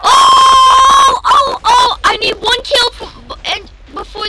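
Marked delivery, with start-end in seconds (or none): 0.0-2.0 long high cry, then shorter ones; drawn-out loud "ohhh". Real, not acted. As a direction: cry out in alarm mid-game. Crying out during game.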